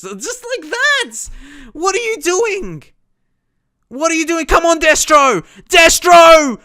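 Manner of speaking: screamed very high and loud, slow at first; furious shout. Real, not acted. Gamer screaming at his striker after a lost ball, furious. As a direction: explode at the striker's blunder. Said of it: "Sorry for getting frustrated".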